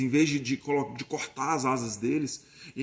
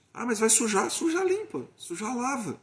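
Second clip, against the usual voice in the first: breezy sing-song; 7 semitones higher than usual. Retold or imitated. Sing-song dismissal of mess.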